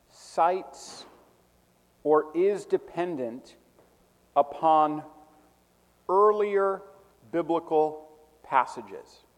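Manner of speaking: slow dictation, pausing after each key word; spaced out, key words stressed; dictating. Real, not acted. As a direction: give it piece by piece for note-takers. Repeating the definition for note-takers.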